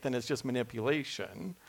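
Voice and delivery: ordinary talk, nothing marked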